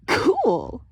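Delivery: high, loud thrilled cool; a kid thrilled by gods; boyish delight. A narrator's reading. Excited boy reacts.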